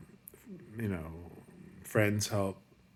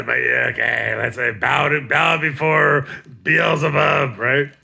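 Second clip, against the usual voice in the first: louder, higher, laughing mock sermon; laughing rant; 6 semitones higher, 10 dB louder than usual. Real, not acted. Comic ranting loudly and laughing about the burger. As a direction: preach about the evil burger, cracking up.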